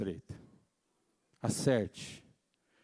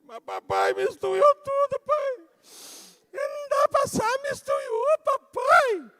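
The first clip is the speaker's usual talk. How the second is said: put-on much higher, pleading child's voice; whiny little boy; 7 dB louder, slower than usual. Small son pleading with his father over the mixed-up trash, whining high. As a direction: whine like a small kid protesting to dad.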